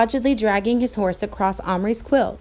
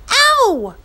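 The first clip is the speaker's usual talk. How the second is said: much higher, louder yelp; sharp cry of pain; 13 semitones higher, 8 dB louder than usual. Acted. Patrick as Omri squeezes the bullet out, yelping. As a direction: flinch out loud.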